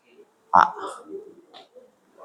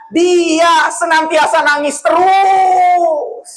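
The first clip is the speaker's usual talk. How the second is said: drawn-out emphasis; 5 semitones higher, much slower than usual. Acted. Stressing the widower's endless crying.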